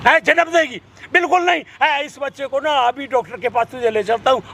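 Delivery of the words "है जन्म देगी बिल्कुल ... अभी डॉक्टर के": put-on husband furiously refusing wife's wish to have the child; angry refusal. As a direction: shut her down hard.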